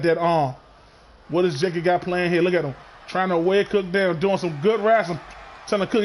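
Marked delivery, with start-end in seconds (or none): none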